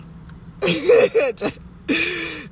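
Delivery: high, drawn-out cackling laugh. Real, not acted. Man amused that Jesus had a father, real high laugh. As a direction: crack up at your own point. Cracks up at his own point.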